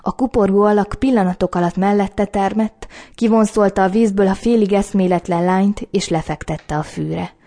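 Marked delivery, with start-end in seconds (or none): none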